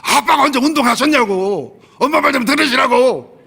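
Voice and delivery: high, loud furious yell at dad; retold shout from the back seat; son yelling back. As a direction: shout it as the angry son defending mom. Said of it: "소리를 버럭 지르는 거야".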